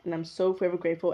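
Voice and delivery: ordinary talk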